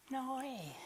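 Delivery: squeaky disbelieving puppet; high little puppet going no way; ventriloquist's puppet scoffing. Acted. Puppet reacts to story.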